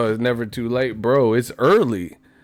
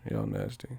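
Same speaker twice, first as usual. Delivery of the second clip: much lower and softer, rising at the end; deep low mumble; 13 dB quieter than usual. Pretend. Teasing the chat in a deep low murmur. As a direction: drop way down and murmur it.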